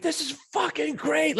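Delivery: high, slow, thrilled retold cry of delight; wild excitement. Radio DJ cranking Whole Lotta Rosie in the studio, ecstatic and high. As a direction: mimic a DJ losing it over a song. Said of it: "she's just losing her shit".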